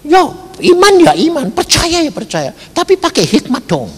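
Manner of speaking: high, quick mock persuasion; mimicked objection. Relatives argue with the believer. (menirukan bujukan saudara, cepat dan tinggi)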